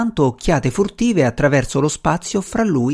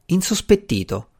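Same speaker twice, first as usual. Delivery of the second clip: ordinary delivery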